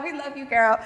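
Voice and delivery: a real laugh through the words; affectionate shout-out that dissolves into laughter. Real, not acted. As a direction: warm shout-out breaking into a laugh. Shout-out to the podcaster.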